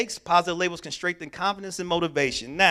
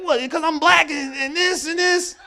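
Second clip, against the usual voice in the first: much higher, loud, mock-indignant protest; indignant outburst; 11 semitones higher, 8 dB louder than usual. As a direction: play his younger angry self. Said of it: "I'm like".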